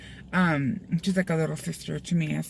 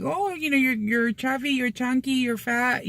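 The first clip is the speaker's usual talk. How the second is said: imitated nagging, pitched up; critical relative; 6 semitones higher than usual. Relatives commenting as you gain weight, mock nagging. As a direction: rattle off criticism in a higher imitating voice.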